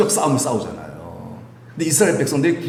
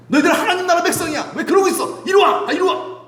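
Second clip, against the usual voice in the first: shouting call. Retold or imitated. Elijah shouting to the wavering Israelites, pleading call. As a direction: shout across to bring them home. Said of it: "이렇게 부르는 거예요".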